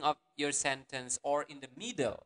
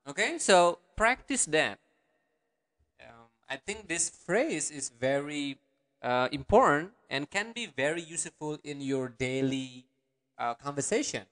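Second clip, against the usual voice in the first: normal talking voice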